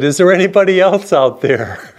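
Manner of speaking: high-pitched comic plea; calling out. Acted. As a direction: call out hopefully for someone else, played for a laugh. Man on branch calls out once more.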